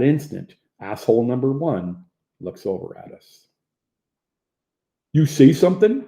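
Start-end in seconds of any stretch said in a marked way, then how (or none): 5.1-6.0 loud, higher-pitched challenge; confrontational challenge. A narrator's reading. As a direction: call him out, looking for trouble. Bully confronts Hopper.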